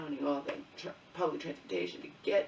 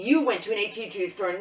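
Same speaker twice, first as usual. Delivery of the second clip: louder, insistent mock accusation; arguing rep; 3 semitones higher, 10 dB louder than usual. Daughter retelling the rep accusing her, loud insistent. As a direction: throw the claim back like the rep did. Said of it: "She's arguing with me".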